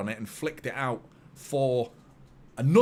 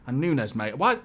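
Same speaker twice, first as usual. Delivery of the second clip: ordinary talk, nothing marked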